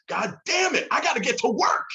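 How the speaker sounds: high, loud angry yell; retold yelling. Acting out an angry passenger late for work, yelling. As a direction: imitate a furious man shouting over the train. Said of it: "and he's yelling".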